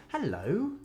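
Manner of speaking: familiar hello. Shown as a demonstration. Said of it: "I'll generally go".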